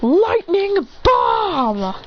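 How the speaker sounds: high, very drawn-out battle cry; stretched anime shout; shouted attack call. Acted. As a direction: call out your special move. Calling out an attack.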